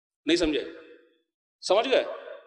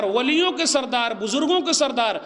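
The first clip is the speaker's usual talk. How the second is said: ordinary delivery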